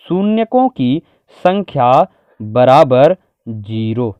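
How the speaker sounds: drawn-out, word by word with pauses; slow dictation. Shown as a demonstration. Teacher giving students the answer to write, slow dictation. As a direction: dictate it slowly as it is written. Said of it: "क्या लिख सकते हैं".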